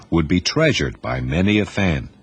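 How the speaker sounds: plain reading voice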